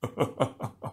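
soft, distant mocking chuckle; an unseen villain's quiet mocking laugh. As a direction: laugh from the shadows at them. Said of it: "Mocking laughter echoed".

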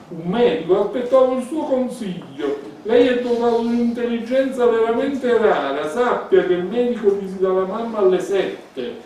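high, loud biting sarcasm; mock his advice scornfully; sarcastic. Elisabetta mocks Gerard's advice. (sarcasmo tagliente e alto)

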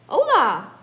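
a loud, high holler across the yard; hollered greeting. Acted. Scapito hollering back to his barking buddies. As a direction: call out hola with full force. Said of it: "hollered back Scapito Frisquito".

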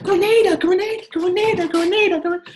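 squeaky, high, loud chanting of Grenada; imitating Michelle. Coach mimicking Michelle's excited call, squeaky and loud. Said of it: "She's like".

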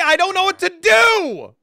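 high, loud cry of despair; desperate shout. Real, not acted. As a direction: wail it out in desperation. Despairs to chat about the play.

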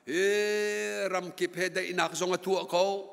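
ordinary talk, nothing marked

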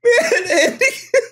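high, squeaky laughing voice; can't finish the line for laughing; laughing hard. Real, not acted. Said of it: "tears is coming out of my eyes".

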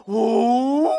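a long spooky ghost hoo; eerie haunted-house hoo; ghostly hoo. Retold or imitated. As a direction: imitate a ghost moaning hoo. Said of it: "diye sesler duymaya başladım".

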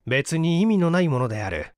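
ordinary delivery, nothing marked

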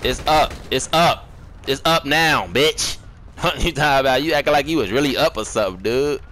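loud, aggressive trash talk; heated challenge. Real, not acted. Player challenging a rival who talks tough, loud and angry. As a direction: call his bluff, loud and heated.

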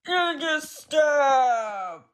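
high, slow crying shout; drawn-out crying yell; shocked cry. Pretend. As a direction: wail it in pretend horror. Character reacts in shock.

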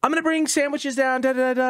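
high mock mom voice. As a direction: chirp it like an eager mother. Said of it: "Mom, all day".

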